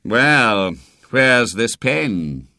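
loud, drawn-out sceptical question; slow and forceful, without any tenderness; sardonic old doctor. A narrator's reading. Doctor doubting the boy in his hospital bed, sardonic. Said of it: "gazing down at him sardonically".

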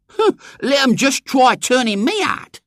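put-on very high, loud girl's challenge; defiant. A narrator's reading. Eglantine shrugging off William's warning, defiant. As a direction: dare them in a high, strident voice.

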